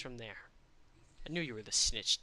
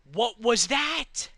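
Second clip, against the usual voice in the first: loud, high, slow disbelief; stunned what; 8 semitones higher, 8 dB louder, much slower than usual. Real, not acted. Streamer asking what just happened, loud stunned disbelief. Disbelief after sudden death.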